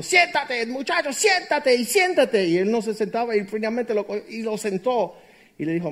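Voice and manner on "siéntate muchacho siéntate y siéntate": higher, commanding teacher voice; teacher ordering a pupil to sit; stern teacher. Retold or imitated. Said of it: "la maestra dijo".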